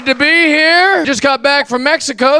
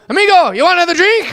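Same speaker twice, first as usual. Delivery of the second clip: bartender voice; 7 semitones higher, faster than usual. Retold or imitated. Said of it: "he goes".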